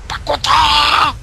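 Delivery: slow, drawn-out mock shout; mocking echo. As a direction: stretch it out to mock them. Mocking teammate's words.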